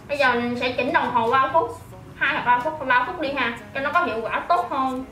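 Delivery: usual speaking voice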